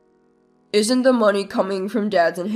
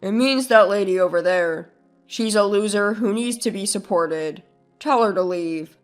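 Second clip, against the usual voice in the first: low, smug, mocking; smug smile; 5 semitones lower than usual. Brother mocking his sister to his son, low and smug. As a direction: point at her and smirk. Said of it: "with a smug smile".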